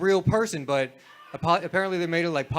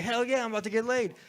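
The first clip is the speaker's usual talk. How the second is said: mock over-excited; 6 semitones higher, 3 dB quieter than usual. Guy thinks the girl said yes, mock excited. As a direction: imitate getting carried away, pitch lifted. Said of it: "too excited".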